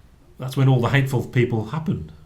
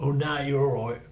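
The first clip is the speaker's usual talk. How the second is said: lightly put-on rock-star voice, raised pitch; 4 semitones higher than usual. Retelling a holiday band invitation, lightly imitating the rock star. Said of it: "[name] was like".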